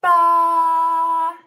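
loud, long BA; sheep bleat. Shown as a demonstration. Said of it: "You say".

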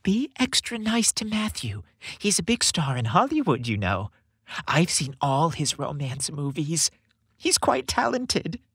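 high, slightly hushed motherly voice; a hint of a whisper, not truly hushed; mild stage whisper. A narrator's reading. Mrs. Claus whispering instructions to the chief elf, lightly hushed. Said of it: "as she whispered".

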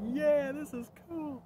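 put-on very high, slow, drawn-out kid voice; delighted little kid. Toddler seeing fireworks, high slow delighted kid voice. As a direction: stretch it out in wide-eyed wonder.

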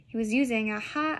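ordinary delivery, nothing marked

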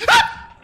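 very high, loud shriek; startled scream. Real, not acted. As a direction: scream at a sudden scare. Jump scare in horror game. (jeritan kaget)

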